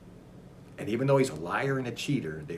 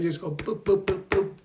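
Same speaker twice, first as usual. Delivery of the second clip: button beeps; 7 semitones higher than usual. Retold or imitated. Mimicking pressing buttons.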